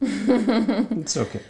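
put-on high, slow Indian accent; drawn-out singsong Indian accent. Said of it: "that Indian accent".

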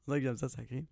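ordinary talk

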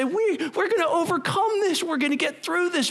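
Holy Spirit encouraging. Acted. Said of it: "The Holy Spirit say".

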